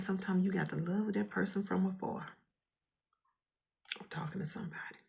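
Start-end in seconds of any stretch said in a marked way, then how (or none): 4.0-5.0 whispered aside. Real, not acted.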